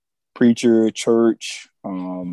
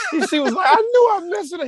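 laughing quote; faster than usual. Retold or imitated. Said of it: "she's like".

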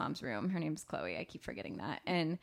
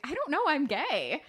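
mock casual guy; 7 semitones higher, 4 dB louder than usual. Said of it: "this guy is like".

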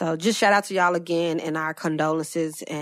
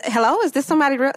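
fast, high-pitched caller; 7 semitones higher, 6 dB louder, much faster than usual. Retold or imitated. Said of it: "caller voiced".

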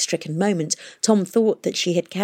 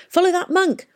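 urgent shout; 12 semitones higher than usual. A narrator's reading. Tom urges the taxi pilot.

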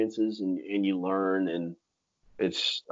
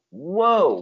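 surprised; 9 semitones higher, 6 dB louder than usual. Retold or imitated. Startled by the gator.

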